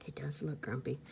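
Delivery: whispered aside. Real, not acted.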